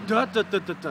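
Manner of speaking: five "duh" notes loud and high, stepping down; descending duh. Shown as a demonstration. Duck-call coach showing the student the hail call.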